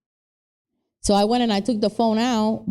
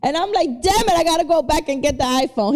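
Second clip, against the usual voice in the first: high, hammed-up shock, much higher pitch; fake surprise; 6 semitones higher than usual. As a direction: act innocent and amazed. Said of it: "I was like".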